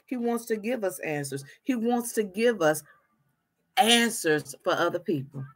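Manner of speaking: ordinary talk, nothing marked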